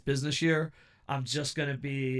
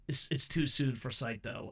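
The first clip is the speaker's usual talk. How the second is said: ordinary delivery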